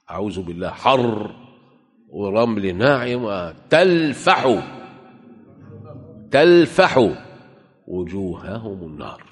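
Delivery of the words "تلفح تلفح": تلفح said high and loud with a falling end; تلفح loud, high, dropping at the end; hard stress on talfah. Shown as a demonstration. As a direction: hammer تلفح twice, hot and forceful. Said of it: "تلفح، مش تنفح".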